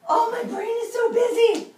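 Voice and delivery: high, loud mock fluster; hammed-up frazzle. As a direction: overplay a frazzled busy brain for the kids. Teacher hams up busy mind.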